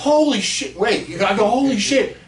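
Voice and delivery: high, loud holy shit; high, loud awestruck exclamation; stunned amazement. Retold or imitated. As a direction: gasp out your shock. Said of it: "I was like".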